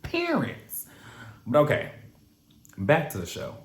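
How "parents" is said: "Parents" squeaked very high, PAR vowel; mock DC "parents". Shown as a demonstration. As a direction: mimic the DC "Parents". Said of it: "P A R, like, not parents".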